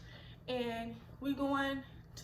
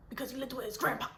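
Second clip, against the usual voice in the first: ordinary delivery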